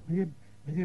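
ordinary talk, nothing marked

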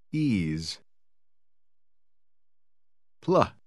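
usual speaking voice

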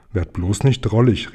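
ordinary delivery

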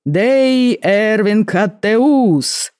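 slow, high, ringing herald's announcement; booming herald. Acted. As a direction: call the name out across the hall, drawn out. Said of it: "раздался зычный голос Герольда". (зычный голос герольда)

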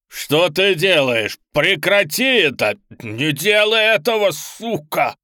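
loud, high, slow frantic demon; screaming demon. Acted. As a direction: scream in panic and rage. Demon screams as Amanda fights. (панический крик демона)

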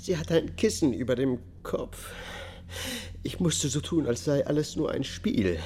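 high, loud, anguished confession; distraught testimony. Acted. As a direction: force the words out through rising distress. Defendant breaks down in court.

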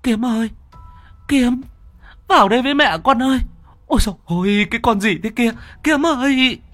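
high-pitched frantic cry; panicked screaming. A narrator's reading. Mother seeing a monster seize her son, panicked screaming. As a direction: call desperately to the child, voice pitched right up. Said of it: "ngoạc mồm gào lên".

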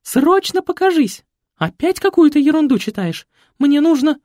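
high, loud, bossy society mother; shrill fussy mother. A narrator's reading. Mother scolding her son, shrill and bossy. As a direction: nag him like a pushy mama.

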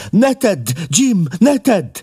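high, loud desperate cry; a wife crying out to stop her husband; pleading shout. A narrator's reading. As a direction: beg him to stop at full voice. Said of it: "kiáltotta".

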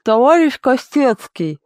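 slow, high whining plea through a toothache; pleading kitchen witch. A narrator's reading. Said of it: "взмолилась кухонная ведьма".

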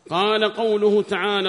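high, loud recitation of a text; declaimed scholarly reading; reading aloud. Acted. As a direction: read it out loudly, pitched well up. Reads the book aloud.

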